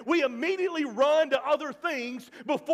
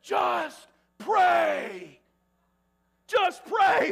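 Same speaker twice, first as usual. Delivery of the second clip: loud whisper; 9 semitones higher, 6 dB louder, much slower than usual. Retold or imitated. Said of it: "as loud as he could whisper".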